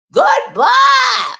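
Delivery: very high, loud shrieked goodbye; hollering goodbye impression; mock yelled goodbye. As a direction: screech goodbye like her. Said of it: "why is she hollering like that".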